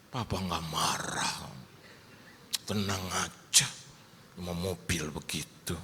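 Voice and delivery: slow, low father's reassurance; calm reassurance. Retold or imitated. Father telling child it is only a car, retold slow calm voice. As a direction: take it slowly and low to soothe. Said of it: "Terus saya bilang".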